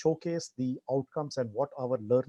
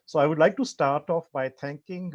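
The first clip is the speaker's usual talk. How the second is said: louder, projecting clearly; speaking up; 2 semitones higher, 7 dB louder than usual. Real, not acted. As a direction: raise the voice so all hear. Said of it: "You'll have to speak up a little".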